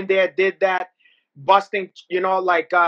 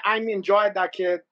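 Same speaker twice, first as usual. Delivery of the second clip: normal talking voice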